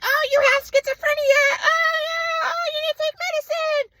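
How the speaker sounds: very high wailing mock-mom screech; hysterical mom. Mom insisting her son is ill, high hysterical wail. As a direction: cry it out as a hysterical mother caricature. Imitating mother freaking out in argument.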